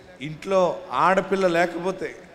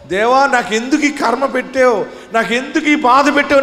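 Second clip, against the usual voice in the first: mock weeping prayer; 7 semitones higher, 4 dB louder than usual. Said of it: "అని ఏడుస్తున్నావేమో".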